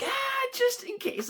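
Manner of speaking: high, slow strained squeal; anxious giving in. Acted. Bidder giving in to nerves, high anxious squeal.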